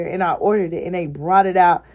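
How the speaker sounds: normal talking voice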